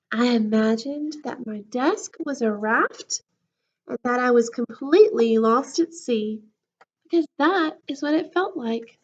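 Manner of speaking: lively storybook voice, big swings of pitch; reading to children. A narrator's reading. Teacher reading a book to her class, lively storybook voice. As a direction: read it to the class like a picture book.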